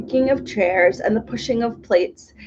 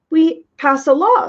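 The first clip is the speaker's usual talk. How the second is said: bright; 7 semitones higher, 4 dB louder than usual. A narrator's reading. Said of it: "suggested the humbug brightly".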